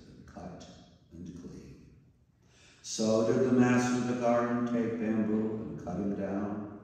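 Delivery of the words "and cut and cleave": voiceless, softer surrender; whisper. Acted. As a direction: hush the last words away. Bamboo's final surrender.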